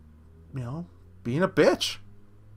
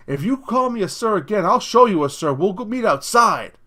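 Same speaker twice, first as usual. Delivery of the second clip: raised, higher-pitched mock challenge; imitated angry threat; 6 semitones higher, 5 dB louder than usual. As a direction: act out someone spoiling for a fight. Retelling the customer's angry threat.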